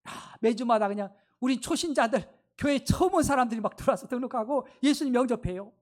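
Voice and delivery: ordinary talk, nothing marked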